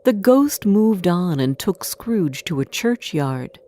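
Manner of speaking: ordinary delivery, nothing marked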